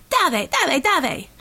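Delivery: high, urgent repeated command; yell. A narrator's reading. Red Savior urging her heroes out the window, yelling. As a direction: urge the troops on in high bursts. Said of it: "she yelled".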